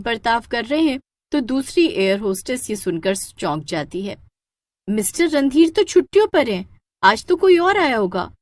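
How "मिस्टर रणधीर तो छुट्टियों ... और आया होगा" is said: surprised. Acted. Air hostess surprised reply.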